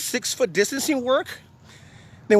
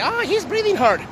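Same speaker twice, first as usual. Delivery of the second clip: high-pitched mock heckler voice; mocking smart-ass; 9 semitones higher than usual. Vlogger mimics viewers who comment on his breathing, mocking high voice. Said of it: "any of you smart asses who wanna say".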